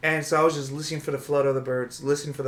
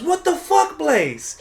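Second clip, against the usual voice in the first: high startled yell; retold yell; 12 semitones higher, 5 dB louder, much slower than usual. Girlfriend yelling from the bathroom, high and loud. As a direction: yell it like she just woke up to bad news. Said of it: "she yells".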